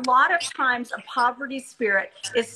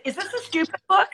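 pointed challenge; 7 semitones higher than usual. Retold or imitated. Confronting a downplaying fellow speaker.

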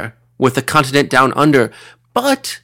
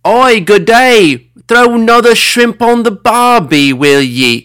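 loud, high-pitched Australian caricature; over-the-top Australian yell; Australian accent; 10 semitones higher, 7 dB louder than usual. Pretend. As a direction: holler it in a hammed-up Aussie accent. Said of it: "might offend with this portrayal".

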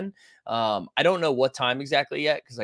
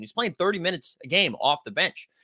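ordinary talk

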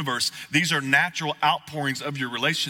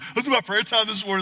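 a higher, churchy retelling; mock pious quote, higher; 8 semitones higher, faster than usual. Preacher imitates churchgoers' prayer-talk, mock pious and higher. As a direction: lift it into a put-on spiritual tone. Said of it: "I don't think they talk this".

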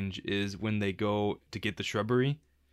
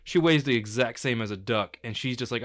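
ordinary talk

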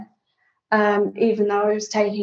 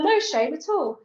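ordinary delivery

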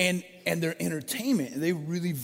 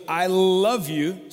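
"I love you" drawn out slowly, a little louder, love held; slow emphatic; 3 semitones higher, 6 dB louder, much slower than usual. Acted. God assuring his people, slow and emphatic. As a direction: lean into each word, slowly. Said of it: "He goes".

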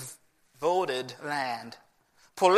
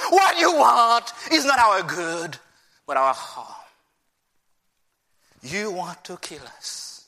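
resentful complaint, fading; bitter accusation. Acted. Acting the people's accusation of God.

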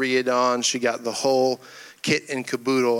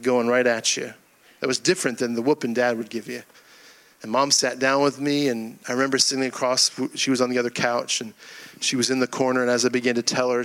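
ordinary talk, nothing marked

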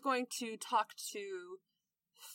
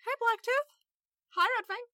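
high, loud perky hello; cheery greeting; 12 semitones higher, 7 dB louder than usual. Acted. Lavender greeting Blacktooth and Red Fang, cheery. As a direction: call out a high-pitched, eager hello.

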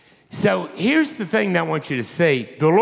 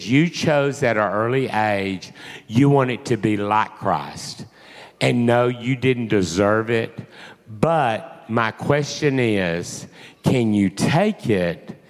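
low, slow, deliberate address; grave, measured challenge; solemn divine message; 6 semitones lower, slower than usual. Retold or imitated. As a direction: speak as the Lord, low and unhurried. Preacher voicing the Lord's message.

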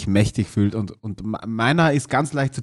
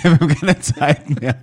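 laughing mock quote; 2 semitones higher than usual. Joking repeat of old man.

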